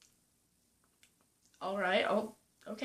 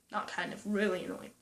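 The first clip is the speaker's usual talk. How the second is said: normal talking voice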